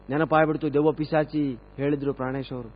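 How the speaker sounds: ordinary talk, nothing marked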